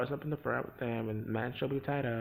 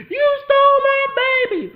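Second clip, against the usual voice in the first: screeching, much higher and louder, slower; mock shrieking dad; 19 dB louder, slower than usual. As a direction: squeal it like a hysterical hillbilly dad. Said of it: "And the dad was like".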